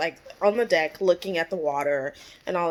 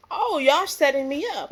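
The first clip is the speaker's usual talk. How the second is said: higher-pitched, drawn-in "oh" of realization; mock accusation; 7 semitones higher than usual. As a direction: act out catching them at a setup. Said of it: "she looks at Ray like".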